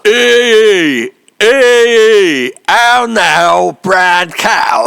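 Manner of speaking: full-volume bellowed proclamation; deafening shout, vowels stretched out; booming town crier. Acted. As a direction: bellow it as the standard to beat. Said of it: "We'll give you a for instance".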